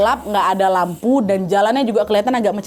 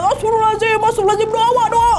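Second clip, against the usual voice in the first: high, frantic prayer cries; retold shriek; 12 semitones higher, faster than usual. Frightened riders calling on each other to pray, shrieked. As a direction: act out panicked praying, pitched way up. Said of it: "sudahlah kami terpekik-pekik di jalan".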